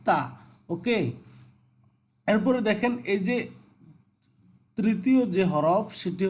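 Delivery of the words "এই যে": usual speaking voice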